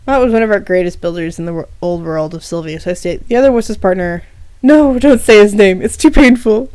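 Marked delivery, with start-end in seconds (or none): 4.6-6.7 slow, louder, hammed-up anguish; milk it like a wounded drama king; dramatic. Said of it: "Mott says dramatically".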